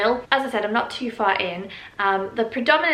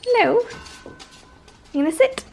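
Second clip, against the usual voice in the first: high excited puppy voice; 11 semitones higher than usual. Real, not acted. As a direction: squeal a greeting to the puppy. Greeting puppy in baby voice.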